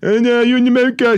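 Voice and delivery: high, sarcastic sing-song; high sneering mockery; mocking. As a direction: throw his question back at him, mocking. Mocking the caller's question.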